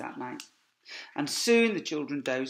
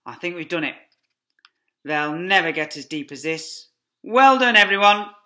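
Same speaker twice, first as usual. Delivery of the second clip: louder relieved praise; proud Mr Fox; 4 semitones higher, 14 dB louder than usual. Acted. Fox praising his family, relieved and proud. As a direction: cheer the family on. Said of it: "fox praising family".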